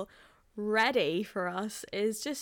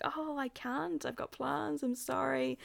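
apologetic excuse; 6 semitones higher, 3 dB quieter than usual. Retold or imitated. Acting out her polite excuse.

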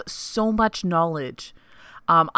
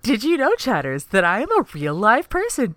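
higher, playful host voice calling out to chatters; a lightly voiced stream announcement, pitched up; mock announcer to chat; 5 semitones higher than usual. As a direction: announce it like a cheesy stream alert. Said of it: "Not a robot".

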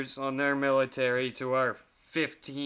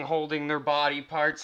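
ordinary delivery

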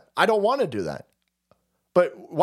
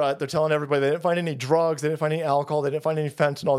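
usual speaking voice

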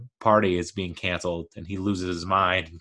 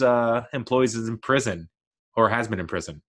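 ordinary delivery

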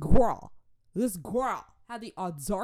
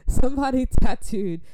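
loud excited burst; 5 semitones higher, 12 dB louder than usual. Real, not acted.

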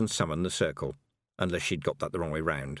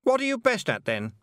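high, louder sharp snap; snapped; 4 dB louder than usual. A narrator's reading. Anagramma snapping at Tiffany, sharp and high. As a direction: bite the question off at her. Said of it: "snapped Anagramma".

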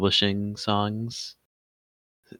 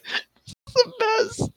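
mock crying; slower than usual. Mock wail over bad podcast.

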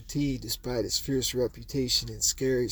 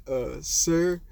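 a hint of a timid, shaky kid; lightly nervous quaver; 5 semitones higher than usual. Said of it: "shaky voice".

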